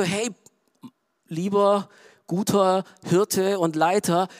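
ordinary talk

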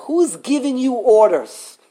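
ordinary delivery

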